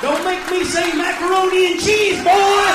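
loud, high yelled threat; ranting at the crowd, a loud high shout. Acted. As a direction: yell it at the crowd like a warning.

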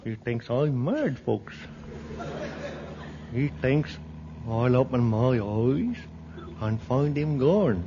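sly confiding aside; low goon voice. Acted.

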